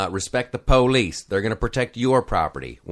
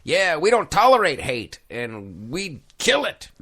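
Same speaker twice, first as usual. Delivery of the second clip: mock protester; 7 semitones higher than usual. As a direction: parody a self-righteous protester. Mocking protester quote.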